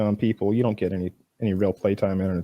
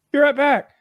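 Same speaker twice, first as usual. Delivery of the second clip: much higher, louder cheater voice; high squeaky quote; 13 semitones higher, 5 dB louder than usual. Retold or imitated. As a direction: squeak it out cheerfully. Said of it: "he's like".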